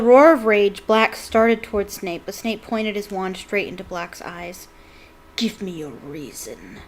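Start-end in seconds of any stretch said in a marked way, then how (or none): 5.4-6.8 slow, soft and menacing; low whisper. A narrator's reading. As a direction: drop the voice low and slow, daring him. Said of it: "he whispered".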